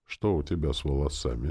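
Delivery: ordinary delivery, nothing marked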